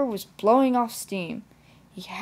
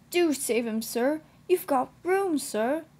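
higher, earnest appeal; pleading; 6 semitones higher than usual. A narrator's reading. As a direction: plead with rising insistence. Edward begs the vicar.

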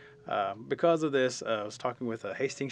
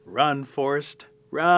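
slow, loud, drawn-out call; stretched, dramatic punchline shout; urging cry; 4 semitones higher, 11 dB louder, much slower than usual. Acted. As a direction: call it out with long pauses. Rabbit's punchline, film quote.

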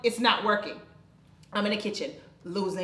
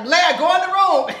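louder, higher urgent command; urgent order; 8 semitones higher, 11 dB louder than usual. Retold or imitated. Father ordering his daughter to her room, loud. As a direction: bark the order at the child. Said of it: "Teddy's like".

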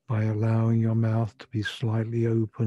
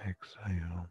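whispering; 5 semitones lower, 12 dB quieter than usual. Acted. Breathing cue in meditation.

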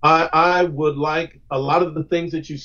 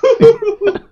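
a sudden high cackle at his own joke; real laugh bursting out; high burst of laughter; 8 dB louder than usual. Real, not acted. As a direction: crack up laughing, pitched way up.

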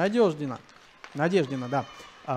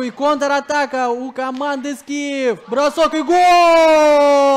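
slow build into a long goal shout; excited goal call; 5 semitones higher, 4 dB louder, slower than usual. Real, not acted. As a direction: stretch it into a big goal call. Counterattack and goal called. (восторженный протяжный крик)

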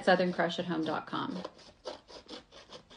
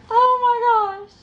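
drawn-out, much higher and louder exclamation; exasperated oh my gosh; 13 semitones higher, 8 dB louder, slower than usual. Real, not acted. Real exasperated surprise. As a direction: crafter overwhelmed by escaping pom-poms, exasperated cry. Balls attacking, pom-poms everywhere.

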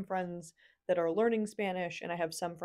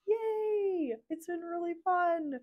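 much higher, bright excited "Yay!"; excited cheer; 11 semitones higher, slower than usual. Real, not acted. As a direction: cheer it up high like a happy sign-off.

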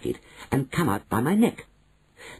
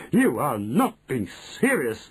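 slow, stiff protest; drawn-out offended protest; manager protesting; 3 semitones higher than usual. A narrator's reading. Manager to grandmother, slow stiff protest.

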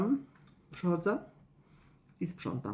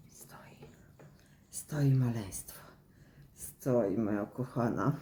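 talking to a pet; 5 semitones lower, 4 dB quieter, slower than usual. Real, not acted. Talking sweetly to her dog.

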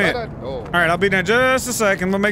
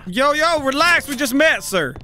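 mock protest; 7 semitones higher than usual. Protesting at a forward stranger.

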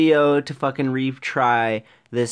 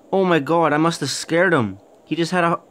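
normal talking voice

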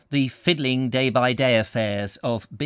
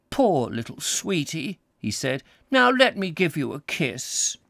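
lightly voiced old canon cooing, higher; tender to baby; 5 semitones higher than usual. A narrator's reading. Canon bending over the baby, tender and higher. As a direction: coo gently over the baby.

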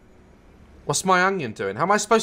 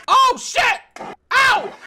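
startled yelp; 13 semitones higher, 4 dB louder, slower than usual. Real, not acted. Startled by bright phone light.

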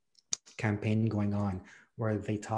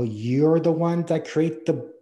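louder, higher indignant complaint; indignant retort; 5 semitones higher, 7 dB louder than usual. Retold or imitated. A wheelchair user blaming those who built stairs, raised and indignant. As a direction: push back as if fed up with the stairs.

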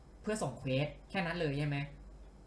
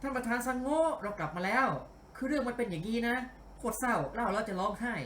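higher, animated game-character voice; เสียงพากย์ตัวละครร่าเริง; 7 semitones higher than usual. Acted. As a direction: report in eagerly to the boss, pitched up. (เสียงพากย์สูง กระตือรือร้น)

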